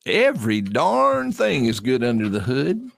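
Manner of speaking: drawling South Carolina governor voice; Henry McMaster accent. Pretend. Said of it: "what is that accent you've got going there".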